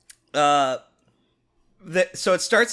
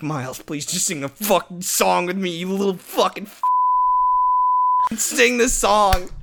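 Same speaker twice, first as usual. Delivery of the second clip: mock exasperated mom; 4 semitones higher, slower than usual. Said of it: "my mom ... being like".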